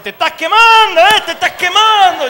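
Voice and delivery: high, loud taunting laughter-tinged yell; elder's mock exorcism shout. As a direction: tell the demon it's burning. Elder anointing with oil. (anciano gritando que se quema, medio riendo)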